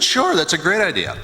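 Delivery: ordinary talk, nothing marked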